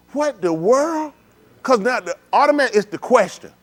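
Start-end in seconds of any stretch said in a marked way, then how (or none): 0.1-1.1 drawn-out, high stunned "What the world?". Acted. Preacher acting stunned by the accident with a drawn-out, high "What the world?". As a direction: stretch "What the world?" out slow and high in disbelief. Acted shocked reaction in the skit.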